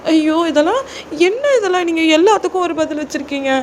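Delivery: high-pitched, flustered complaint; exasperated junior girl. Acted. Junior girl complaining that senior answers everything, flustered exasperation. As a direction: whine in a high girl's voice.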